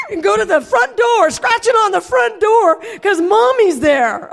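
high, loud, frantic retelling; excited shouting. Retold or imitated. Owner telling how her dog greets her, excited shouting.

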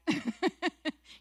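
quick laugh at your own joke. Real, not acted. Speaker joking to the room about their accent, amused laugh.

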